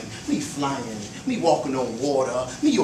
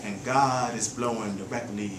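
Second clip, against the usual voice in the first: softer, lower closing verse; quiet poem ending; 4 semitones lower, 4 dB quieter than usual. Acted. Poet closing the poem, soft and low. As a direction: let it fade out tenderly.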